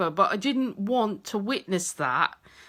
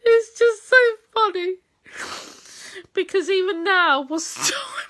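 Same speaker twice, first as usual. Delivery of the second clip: much higher and louder, tearful laughter; crying with laughter; 8 semitones higher, 4 dB louder than usual. Real, not acted. Wife unable to stop laughing at the frog hunt, crying with laughter. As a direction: laugh until the words crack.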